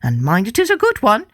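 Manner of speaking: put-on wife bossing her husband, a greedy old woman's voice. A narrator's reading. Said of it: "cried the greedy old wife".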